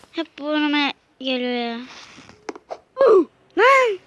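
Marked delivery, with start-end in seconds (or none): 3.6-4.0 high, loud startled cry; startled shout. Real, not acted. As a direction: yelp in surprise. Sudden game death.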